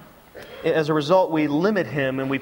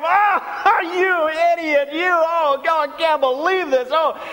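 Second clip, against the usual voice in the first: much higher and louder, jeering laugh at a clumsy nerd; mocking ridicule; 9 semitones higher, 3 dB louder, slower than usual. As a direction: sneer and cackle at him like a cruel kid. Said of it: "we break down and we mock him".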